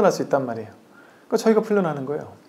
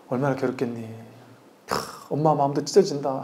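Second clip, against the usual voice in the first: softer, mock heartbroken mother; pretend sympathy; 3 semitones lower, 7 dB quieter than usual. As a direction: soothe the child with pretend grief. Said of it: "괴로운 척 해주면서". (괴로운 척하는 다정한 엄마 목소리)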